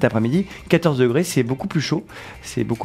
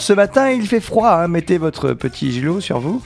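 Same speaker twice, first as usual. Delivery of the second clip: weather presenter; 6 semitones higher than usual. Pretend. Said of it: "bulletin météo complètement improvisé".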